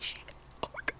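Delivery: whispered panic to oneself; shocked whisper. Retold or imitated. Daughter finding dad at the door, shocked whisper. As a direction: barely voice it, frozen in alarm.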